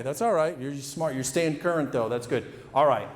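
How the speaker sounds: ordinary talk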